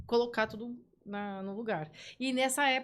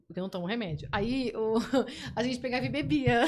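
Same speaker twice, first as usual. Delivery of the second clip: ordinary talk, nothing marked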